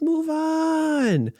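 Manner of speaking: dismissive mock chant of "move on"; brushing off a scandal in a high mocking voice; sarcastic. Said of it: "I'm being sarcastic here again".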